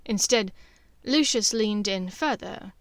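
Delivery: ordinary delivery, nothing marked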